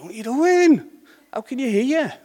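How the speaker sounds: acted scolding, high and loud; exasperated outburst. Speaker scolding drivers with earphones in, loud exasperated outburst. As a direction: call out in disbelief, pitch shooting up.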